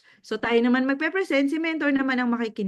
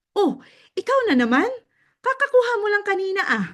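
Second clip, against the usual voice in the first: surprised cashier; 7 semitones higher, faster than usual. Retold or imitated. Cashier voiced in story.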